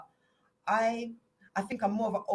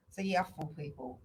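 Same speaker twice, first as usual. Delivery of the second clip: usual speaking voice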